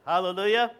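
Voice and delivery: ordinary talk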